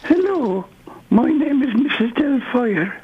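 very high falsetto lady; ropey high-pitched character impression; Mrs Doubtfire. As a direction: put on a squeaky film-nanny greeting. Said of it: "This is [name] being Missus Doubtfire".

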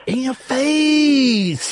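slow triumphant gloating shout. Real, not acted. Gloating at co-host over close call.

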